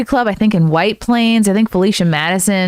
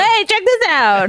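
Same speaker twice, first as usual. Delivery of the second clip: high-pitched goofy shout-out; cartoonishly eager, pitched way up; mock excited call; 13 semitones higher than usual. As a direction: pipe up high, showing it off as a joke. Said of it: "be like".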